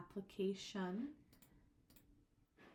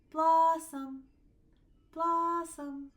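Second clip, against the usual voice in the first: blossom called out higher and louder, stress on blos; dog-call stress; 8 semitones higher, 14 dB louder, much slower than usual. Shown as a demonstration. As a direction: shout blossom like calling the dog in for dinner. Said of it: "Calling your dog in for dinner".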